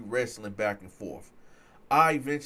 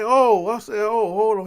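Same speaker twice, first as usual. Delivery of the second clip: loud, high startled "oh"; retold alarm, pitched up and loud; 8 semitones higher, 12 dB louder than usual. Teller backing away from a swing, alarmed.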